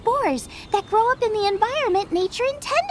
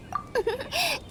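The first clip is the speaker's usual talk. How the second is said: short embarrassed laugh; giggling self-consciously; sheepish laugh; 6 semitones higher, 7 dB quieter than usual. Acted. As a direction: laugh at her own excitement. Sheepish laugh about herself.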